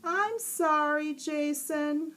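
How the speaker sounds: mock apology. A narrator's reading. Prankster girl apologizing, slow and fake. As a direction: drag the sorry out, insincere.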